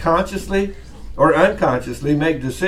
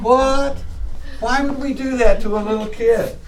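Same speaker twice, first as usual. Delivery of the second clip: higher, incredulous question; mock disbelief; 8 semitones higher than usual. Teacher asking class who'd do that to kid, mock disbelief. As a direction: protest in playful astonishment. Acted disbelief at students' answer.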